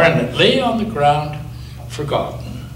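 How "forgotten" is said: ordinary delivery, nothing marked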